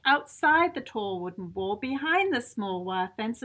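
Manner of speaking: plain reading voice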